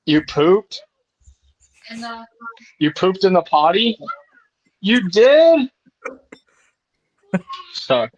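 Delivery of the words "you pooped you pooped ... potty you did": excited praise. Real, not acted. Praising toddler for potty success.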